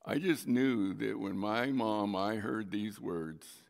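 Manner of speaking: ordinary delivery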